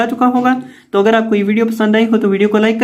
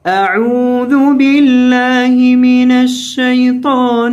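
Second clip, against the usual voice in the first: melodic Quran recitation; 2 semitones higher, much slower than usual. Acted. Teacher reciting the whole surah in tilawat style. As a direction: chant it in full tajweed melody. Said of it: "تلاوت کے انداز میں دیکھ لیں".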